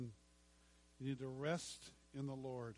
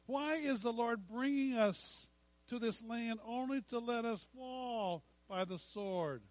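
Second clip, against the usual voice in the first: higher, louder whining protest, rising to a shout; whiny complaining; 5 semitones higher, 3 dB louder than usual. Acted. Preacher acting the Israelites grumbling at Moses, loud whining. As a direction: complain loudly like a crowd fed up in the desert. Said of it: "Did I say it in a whiny enough voice?".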